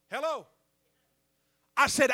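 loud, high, ringing sermon delivery; full-force preaching. Acted. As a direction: preach it hard to the whole room.

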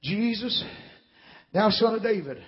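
hushed mock cry to Jesus; subdued retold cry. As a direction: quote the cry quietly, holding back the volume. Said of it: "he's crying loud as he can".

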